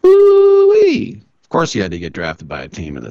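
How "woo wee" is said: much higher, loud Southern whoop. Pretend. The Southern gentleman whoops with joy, high and loud. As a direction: let out a gleeful hollering woo-wee.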